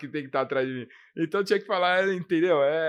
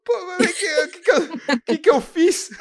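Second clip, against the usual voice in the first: bewildered protest; 8 dB louder than usual. Retold or imitated. Retelling confused reply to youth.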